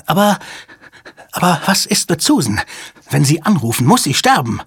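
high, stammering alarm; panicked protest. Acted. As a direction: protest in a panic, pitch jumping up. Hostage taker panics at being called.

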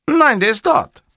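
very high, loud answer; hapless caller Cattarella. Acted. Cattarella answers about Fazio, high and loud. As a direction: pipe up like an eager underling.

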